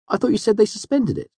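high-pitched, puzzled protest; surprised friend. A narrator's reading. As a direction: question it in a high voice, taken aback. Friend reacts to whipping news.

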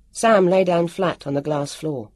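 ordinary delivery, nothing marked

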